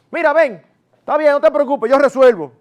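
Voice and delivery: loud, high, fed-up snap taking over; mother cuts in, impatient and loud; exasperated mother. Acted. A mother loses patience and takes over from the father, exasperated loud snap. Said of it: "echa un chuipiti".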